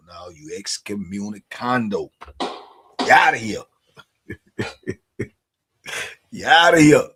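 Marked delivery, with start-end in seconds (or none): 2.4-3.6 You outta here, said slowly; a slow playful kiss-off; mock banishing. Banishing a blabbing viewer. 6.3-7.1 You outta here, shouted like an umpire; loud mock ejection. Banishing a blabbing viewer.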